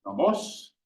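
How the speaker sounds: nomos with a sharp rise in pitch; rising acute on nomos; acute accent rise. Shown as a demonstration. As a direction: say nomos climbing on the last syllable. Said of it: "sharp rise in pitch".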